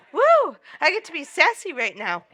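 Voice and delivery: loud, high sassy whoop. Pretend. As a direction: let loose with sass. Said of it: "I'm in the sassy fifties".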